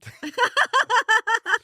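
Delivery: high rueful laugh; bright self-mocking laughter; real laugh. Real, not acted. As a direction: laugh at the memory. Laughing at own failure.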